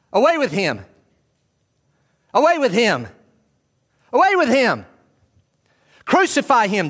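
high, loud crowd shouting for crucifixion. Acted. Preacher voicing the crowd before Pilate, loud mob shouts. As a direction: yell it like an angry mob. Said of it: "They cried out".

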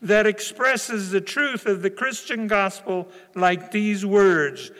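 loud, high, ringing pulpit build-up; full-force preaching. Acted. Pastor to congregation, leading into John 3:16, full-force preaching. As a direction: preach it out to the whole room.

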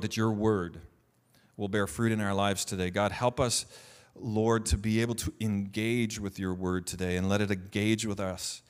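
low, steady praying voice; prayer. Real, not acted. Pastor asking God to speak through him, low prayer. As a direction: pray it low and measured, pausing between phrases. Leads a prayer before preaching.

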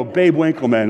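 usual speaking voice

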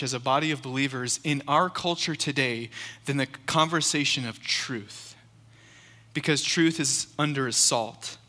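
normal talking voice

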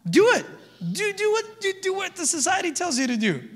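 very high-pitched sarcastic urging; mocking encouragement. Preacher sarcastically urging people to follow society, mocking. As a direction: egg them on in a mocking high voice.

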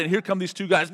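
ordinary talk

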